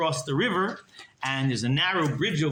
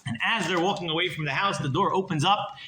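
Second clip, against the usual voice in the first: usual speaking voice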